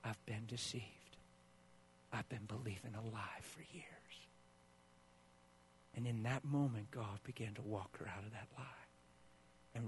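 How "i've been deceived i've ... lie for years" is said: hushed realization. Retold or imitated. Woman's realization retold quietly.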